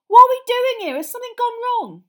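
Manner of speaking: very high, loud questioning; confused boy. Acted. Ron joining the others in fireplace, confused shout. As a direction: pipe up over the others, puzzled.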